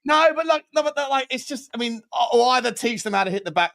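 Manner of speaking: exasperated about poor penalties, voice riding high; worked up. Real, not acted. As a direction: rant about the penalties, pitch jumping up. Ranting about the penalty standard.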